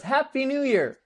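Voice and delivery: high, loud New Year's shout; mock party cheer. Party crowd cheering at the show, high and loud. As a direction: cheer it like the whole room at midnight.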